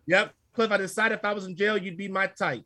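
normal talking voice

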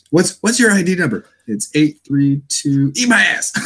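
a hint of a cranky emperor; imitating a grumpy emperor with a high pitch; mock Palpatine. Voicing Palpatine sketch.

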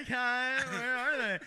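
mock-drunk question; drunk bar-goer. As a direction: answer like a trusting drunk. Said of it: "And she's like".

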